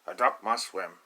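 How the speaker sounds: loud firm father duck; stern, loud duck father; papa duck. Acted. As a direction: lay down the law as Papa. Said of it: "Papa says".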